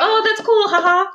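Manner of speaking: high, bright-ish chirpy texting tone. Retold or imitated. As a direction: sound peppy like a casual text. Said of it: "I'll be like".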